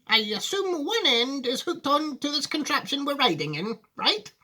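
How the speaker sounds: put-on high-pitched, doubting old lady; Grandma Josephine. Grandma questioning Wonka about the contraption, high and doubtful. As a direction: quiz him in a high granny voice. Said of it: "said Grandma Josephine".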